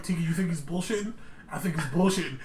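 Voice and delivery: soft, low conspiring aside; mock secret aside. Asking Tiki at the card game, softly conspiring. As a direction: lean in and ask quietly. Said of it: "I'm like".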